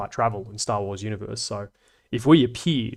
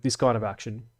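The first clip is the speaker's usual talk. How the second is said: ordinary delivery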